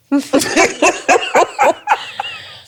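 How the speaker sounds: loud, high burst of laughter; helpless high-pitched laughing fit; high cackling laugh. Real, not acted. Cracking up at her own joke.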